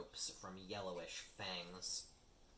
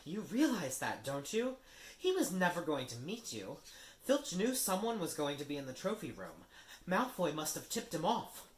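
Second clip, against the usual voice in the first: Hermione, urgent; 8 semitones higher, 7 dB louder than usual. Acted. Hermione scolds Harry, high and insistent. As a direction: spell out the trick to him.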